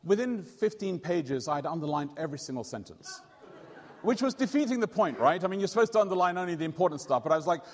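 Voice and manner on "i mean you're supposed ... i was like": ordinary talk, nothing marked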